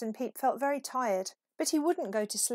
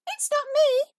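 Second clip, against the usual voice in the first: much higher, louder squeaky mouse voice; 10 dB louder than usual. A narrator's reading. Narrator voicing the mouse answering Peep, high and squeaky. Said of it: "squeaked the mouse".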